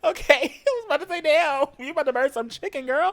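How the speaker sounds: laughing. Real, not acted. Laughing relief, then teasing.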